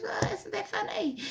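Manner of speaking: a light take on Marie, pitched well up; Marie's surprised reply. Retold or imitated. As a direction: play her amused and high. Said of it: "She goes".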